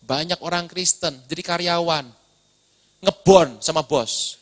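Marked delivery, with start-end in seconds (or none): none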